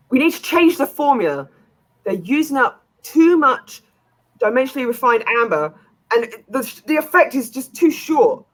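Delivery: high, earnest researcher worrying over the formula. Acted. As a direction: pitch the voice up as a fretful researcher. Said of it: "You hear one of them say".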